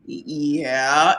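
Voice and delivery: low, drawn-out wary yeah, rising; guarded, questioning drawl; suspicious. Retold or imitated. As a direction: answer the phone like you distrust the caller. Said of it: "Who the hell are you and what do you want?".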